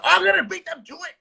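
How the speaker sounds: high, eager mock rival; mock copycat. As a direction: parody a greedy competitor, pitch up. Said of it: "plant a fictitious seed".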